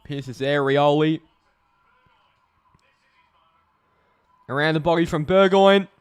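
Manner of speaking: rising pitch and volume through the call; commentary climbing to a loud peak; building match call. Real, not acted. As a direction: build the suspense as the shot goes up. Calling a shot at goal.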